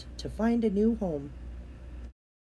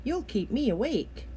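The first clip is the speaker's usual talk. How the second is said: higher, put-on grumpy turtle voice; lightly voiced grumbling turtle; 4 semitones higher than usual. A narrator's reading. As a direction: complain like a cranky old turtle. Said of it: "grumbled Turtle".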